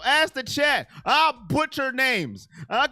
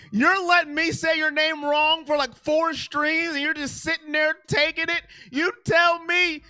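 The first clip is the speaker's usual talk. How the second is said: excited rant; 8 semitones higher, 4 dB louder than usual. Real, not acted. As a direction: pitch up and louder, fired-up teasing at the chat. Calling out chatter over name.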